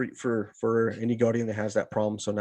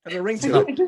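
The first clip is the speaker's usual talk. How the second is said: laughing; 13 semitones higher than usual. Real, not acted. Laughing at the name.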